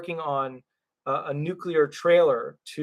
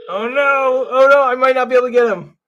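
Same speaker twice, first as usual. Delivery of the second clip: raised, loud "oh no" of dismay; 8 semitones higher, 9 dB louder than usual. Real, not acted. Host losing hope of reaching his guest on the phone, loudly dismayed. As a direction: let disappointment burst out high and loud. Call to buddy not connecting.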